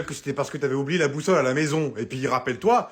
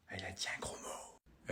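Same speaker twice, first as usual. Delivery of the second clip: hushed whisper, very low; whispered tattle; 9 semitones lower, 19 dB quieter than usual. Acted. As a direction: whisper it like telling a secret. Child tattles in aside.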